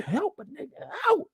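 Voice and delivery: high squeaky plea for help; high mock Ellie. Voicing Ellie.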